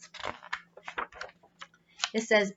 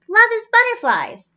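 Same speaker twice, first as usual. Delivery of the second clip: put-on child voice; 11 semitones higher, 7 dB louder than usual. Kid's answer voiced.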